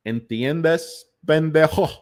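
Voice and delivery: hammed-up Scarface Spanish accent, muddled; bad Cuban gangster accent, half-German; terrible Cuban accent. Tony growling in a bad Cuban accent. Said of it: "your accent is terrible".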